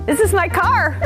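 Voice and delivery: normal talking voice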